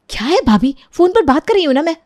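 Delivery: annoyed retort. Acted. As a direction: brush off the interruption irritably. Annoyed at being interrupted. (चिढ़कर तेज़ी से जवाब)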